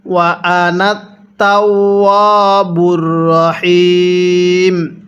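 ওয়া আনা short, তাওয়াবুর রহিম slowly chanted; slow recitation. Shown as a demonstration. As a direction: recite without stretching আনা. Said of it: "কিভাবে পড়তে হবে?".

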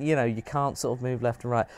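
normal talking voice

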